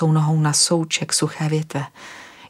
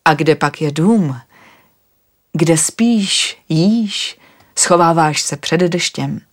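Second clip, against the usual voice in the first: louder, slower, alarmed city man asking; worried traveller firing questions, a bit louder; agitated questions; 4 dB louder, much slower than usual. Acted. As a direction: press her, uneasy and demanding.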